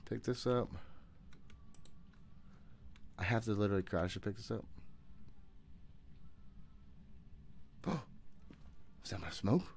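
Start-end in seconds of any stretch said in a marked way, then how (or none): 4.7-9.0 frightened gasp. Real, not acted. Sudden ghost event.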